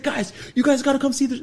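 high, loud, breathless call; urgent excitement. Retold or imitated. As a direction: rouse them, you've seen a miracle. Friend wakes roommates urgently.

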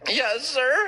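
high-pitched, meek little squeak; timid reply. Retold or imitated. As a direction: answer like you're scared of the big guy. Meek answer to menacing big dude.

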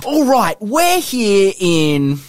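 high, excited Australian wildlife-host voice; hammed-up Australian croc hunter; Steve Irwin accent. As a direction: present crocs like Steve Irwin. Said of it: "Steve Irwin".

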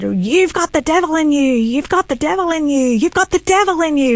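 high-pitched screaming accusation, repeated insistently; imitated angry yelling. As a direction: mimic an enraged father screaming an accusation. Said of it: "he's just yelling and screaming at me like this".

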